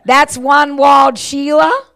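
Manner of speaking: slow, loud hammed-up Australian; Aussie catchphrase. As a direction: play up the Australian accent. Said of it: "Is that what you want?".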